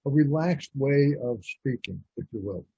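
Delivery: usual speaking voice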